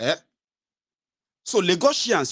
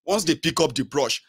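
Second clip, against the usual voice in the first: ordinary talk, nothing marked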